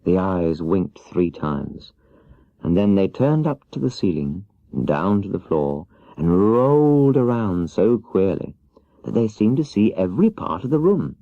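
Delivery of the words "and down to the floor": ordinary delivery, nothing marked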